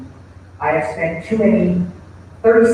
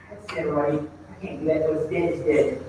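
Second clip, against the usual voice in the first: resigned inner voice; 4 semitones lower, 7 dB quieter than usual. Retold or imitated. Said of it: "and then say".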